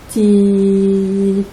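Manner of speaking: "ti" repeated slowly as a lone syllable. Shown as a demonstration. Teacher hunting words that start like Timéo, repeating. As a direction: chant "ti, ti" while searching for words. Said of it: "On peut répéter la première syllabe".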